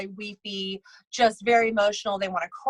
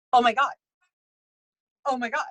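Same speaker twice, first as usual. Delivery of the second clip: mild retold freak-out, a bit louder on the first; 3 semitones higher, 3 dB louder, faster than usual. Bride told a spirit is present, mildly freaking out. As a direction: lightly act a stunned bride gasping it twice. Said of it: "she started kind of freaking out".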